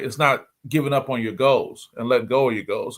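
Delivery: usual speaking voice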